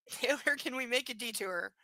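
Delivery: deadpan reread of the line; book narration. Acted.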